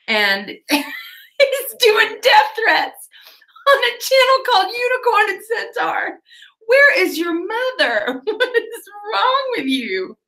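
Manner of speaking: high, squealing, drawn-out disbelief through laughter; incredulous laughing rant. Real, not acted. Ranting at the absent troll on her unicorn stream, laughing incredulous shriek. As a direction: shriek it slowly, cracking up.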